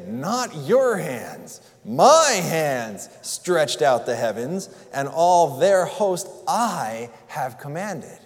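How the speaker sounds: "my" stretched out and stressed, slowly; slow, stately, stress "my hands"; emphatic God. Acted. Preacher voicing God claiming the creation, stressing "my". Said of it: "you can almost hear him say".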